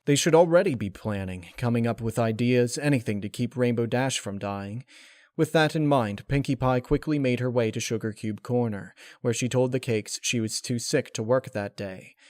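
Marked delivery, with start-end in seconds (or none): none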